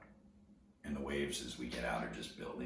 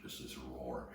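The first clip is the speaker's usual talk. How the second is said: ordinary talk, nothing marked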